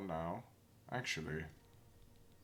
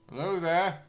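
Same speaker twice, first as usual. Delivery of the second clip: hammy knight greeting; 10 semitones higher, 9 dB louder than usual. Acted. Greeting stranger in character.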